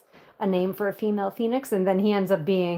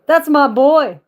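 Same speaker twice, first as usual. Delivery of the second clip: loud proud shout; proud cheer; 5 semitones higher, 11 dB louder, slower than usual. Real, not acted.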